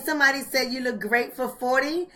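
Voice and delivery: ordinary talk, nothing marked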